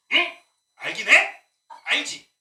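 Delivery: irritated preacher snapping at the pews; sharp and irritated. Retold or imitated. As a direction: needle the congregation. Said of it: "막 이렇게 날카로워지고".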